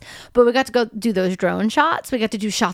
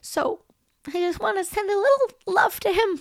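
choked up; 7 semitones higher than usual. Real, not acted. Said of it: "I'm s-, I'm sorry".

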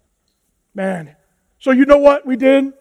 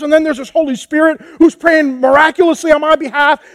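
ordinary talk

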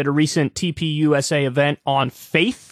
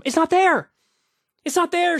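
higher, stressed not; insisting humility is missing; high insistent it's not there; emphatic repeat; 10 semitones higher than usual. Real, not acted. Emphatic complaint.

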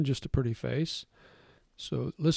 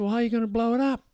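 higher, quick, puzzled passer-by; curious stranger; 9 semitones higher, 4 dB louder, much faster than usual. Retold or imitated. Passer-by doubts the cyclist can inflate his tire, fast and high. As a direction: rattle off a stranger's doubtful question.

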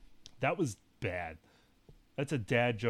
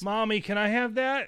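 higher, lightly voiced pleading child; a hint of a kid asking mommy; kid begging mom; 7 semitones higher, 3 dB louder than usual. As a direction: wheedle a little, like a child.

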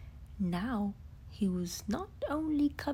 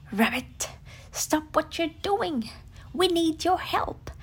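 louder, higher breathless urgency; urgent call; 6 semitones higher, 8 dB louder than usual. Acted. Tigger urges Rabbit to stop and help. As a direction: burst in demanding help. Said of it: "explained Tigger".

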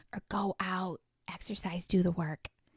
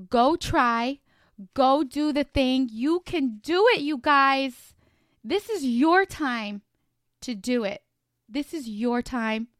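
loud, slow, pumped-up push; rally the listeners to go for it; fired-up cheering on; pep talk; 4 semitones higher, 6 dB louder, slower than usual. Real, not acted. Energetic final pep talk.

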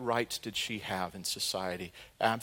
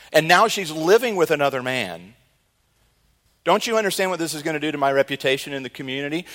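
loud, high, pleading outrage; a wronged husband arguing with God, loud and high; indignant protest; 6 semitones higher, 11 dB louder than usual. Acted.

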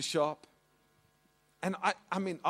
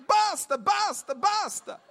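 much higher, louder shouted Basta; shouting basta; 9 dB louder than usual. Retold or imitated. Mum shouting at the butcher to stop, loud Basta. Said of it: "she shouts out".

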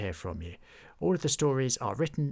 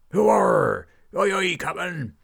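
loud, much higher cheer; crew cheering; 8 semitones higher, 10 dB louder than usual. Acted. Pirate crew cheering their captain, loud shout. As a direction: whoop like a rowdy pirate crew. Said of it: "The crew shouted their approval".

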